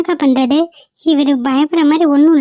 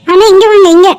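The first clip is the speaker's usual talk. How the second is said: loud, higher-pitched hail; calling out; 5 semitones higher, 8 dB louder than usual. Acted. Girl waving down the arriving ambulance, calling out. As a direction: call them over urgently.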